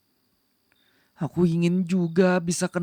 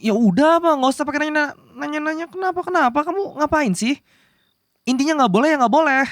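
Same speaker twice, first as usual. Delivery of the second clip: flustered insistence; 8 semitones higher than usual. Acted. Flustered, refusing to explain.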